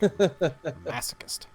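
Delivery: laughing out the word slowly; drawn-out laughing jab; laughing tease. Real, not acted. Teasing laugh at friend.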